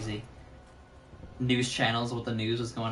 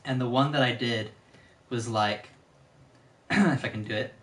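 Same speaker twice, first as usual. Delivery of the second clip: ordinary delivery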